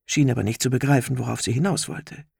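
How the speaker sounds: plain reading voice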